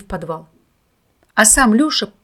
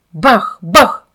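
put-on boyish gun sounds, loud; cannon noises; 5 dB louder than usual. A narrator's reading. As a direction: shout like a cannon firing. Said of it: "сам ответил за свою пушку".

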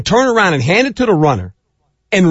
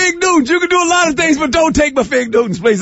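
mock plea. Pleading over Fig Newtons.

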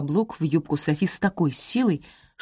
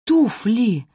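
higher, more forceful cry; exclaimed realisation; 6 semitones higher, 4 dB louder than usual. A narrator's reading. Mother repeats her discovery to the daughters, exclaiming. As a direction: throw out ТУФЛИ as a discovery.

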